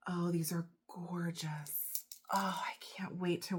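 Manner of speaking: hushed, breath-filled delight; awed whisper. Real, not acted. Vlogger admiring a gifted necklace, awed whisper. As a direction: whisper it in wonder.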